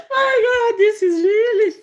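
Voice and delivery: high, loud laugh-crying through the words; can barely speak for laughing. Real, not acted. Laughing at her own story.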